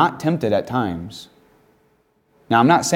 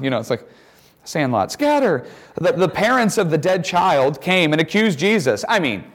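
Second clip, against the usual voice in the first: ordinary talk, nothing marked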